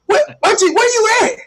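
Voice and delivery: high, stunned question; shocked at auntie; incredulous. Retold or imitated. Incredulous reply to aunt.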